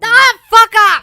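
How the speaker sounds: loud, high shout of disgust; screaming in disgust at a crude message; disgusted yell. Real, not acted. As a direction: yell it off in revulsion. Disgusted at the gross donation.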